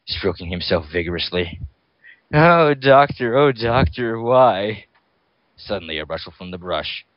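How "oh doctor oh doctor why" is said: slow, louder, higher moaned words; moaning. Acted. Alone, fantasizing about Doctor, slow high moaning. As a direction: stretch each word out like a moan. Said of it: "He moaned".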